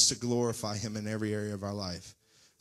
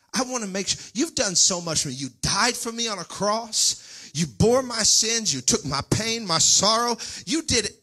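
high, loud sung preaching climax; whooping gospel preacher rising to a cry; full-force preaching; 12 semitones higher, 11 dB louder than usual. Acted. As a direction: lift it into a sung, shouted praise.

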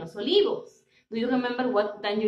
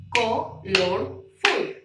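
slow syllables; much slower than usual. Shown as a demonstration. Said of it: "Vamos a recordar qué son las sílabas".

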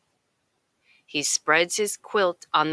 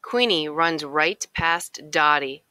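ordinary delivery, nothing marked

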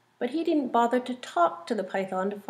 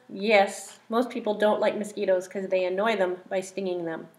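plain reading voice

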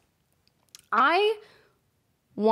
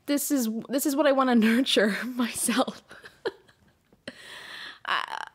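whispered words breaking into a laugh; laughing at herself, half whispered; 2 semitones higher than usual. Real, not acted. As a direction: giggle through it under your breath.

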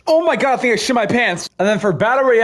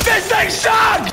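frustrated shout; 12 semitones higher, slower than usual. Real, not acted. Frustrated with gun.

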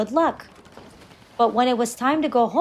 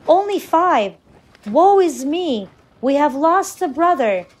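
mildly hammed-up lament, higher and louder; sorrowful; 4 semitones higher, 5 dB louder than usual. A narrator's reading. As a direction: cry out in dismay at a loss. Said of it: "In his sorrow".

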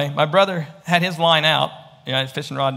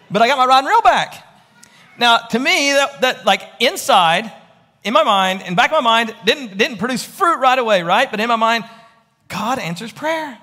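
louder and higher, fervent pulpit voice; full-force preaching; 9 semitones higher, 6 dB louder than usual. Acted. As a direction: drive the point home to the congregation.

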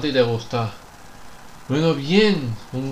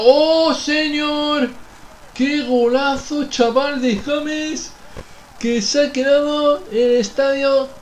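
ecstatic goal shout; 10 semitones higher, slower than usual. Real, not acted. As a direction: scream the goal like a fan in the stands. Celebrating a great goal.

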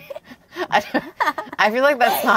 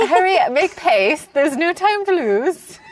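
hammed-up British old lady; British accent; 4 semitones higher than usual. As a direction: hurry them along in mock British. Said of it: "talk in a British accent from now on".

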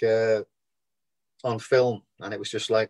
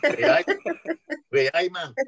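jokey Geordie catchphrase; higher, hammed-up Geordie greeting; mock Geordie accent; 7 semitones higher than usual. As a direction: put on a playful Geordie accent. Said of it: "your Scouse accent in Billy Elliot".

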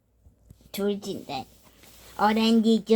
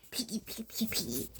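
whisper; 3 semitones lower, 16 dB quieter, faster than usual. Pretend. Toy whispering a name.

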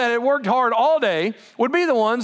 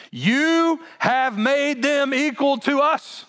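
slow, higher resentful protest; indignant workers; 6 semitones higher, much slower than usual. Retold or imitated. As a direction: drag each word out in resentment. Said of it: "notice this next statement".

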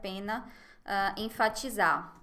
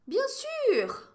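quoted mayor; 13 semitones higher, 5 dB louder than usual. Retold or imitated. Quoting the grumpy mayor.

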